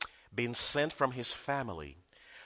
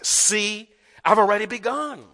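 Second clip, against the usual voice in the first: "See, I've already begun" raised high and loud; stress on already begun; 6 semitones higher, 8 dB louder than usual. Shown as a demonstration. Preacher stressing the new reading to congregation, high and loud. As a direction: proclaim it as the new emphasis. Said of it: "but rather to emphasize".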